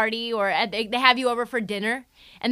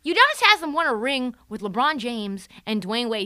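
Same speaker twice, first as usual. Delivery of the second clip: ordinary talk, nothing marked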